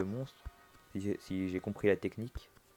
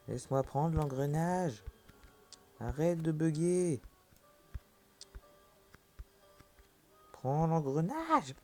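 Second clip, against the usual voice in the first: exasperated pleading; 6 semitones higher, slower than usual. Real, not acted. Frustrated pleading with bug.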